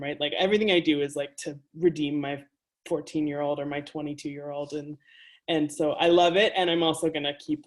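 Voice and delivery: ordinary delivery, nothing marked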